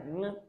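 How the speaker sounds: "Ng" as one velar nasal hum; "Ng" with no hard g; ng sound. Shown as a demonstration. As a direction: hum "Ng" at the back of the mouth. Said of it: "is called ng sound".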